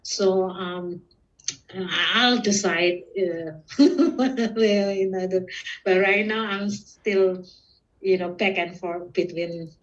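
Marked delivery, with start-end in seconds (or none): 3.6-4.1 high, loud burst of laughter; undecided little laugh; short laugh. Real, not acted.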